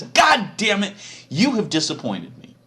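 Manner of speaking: high, loud furious shout; furious yell. Acted. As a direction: roar it as the tablets shatter. Moses smashing tablets, scolding.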